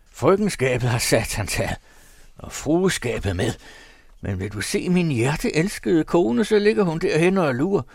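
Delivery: jeering husband mocking his wife; coarse cursing man. A narrator's reading. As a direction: spit it out like an oath. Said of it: "Han stødte en høj ed ud".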